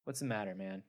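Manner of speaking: lightly voiced coworker encouraging a buddy; gruff keeper. Keeper pep-talks the octopus.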